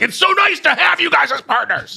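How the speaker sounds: high, loud gushing shout; mock yelling. Shown as a demonstration. Host showing co-host what yelling praise would be, shouted. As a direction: yell the compliment far over the top. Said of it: "I wasn't yelling at you".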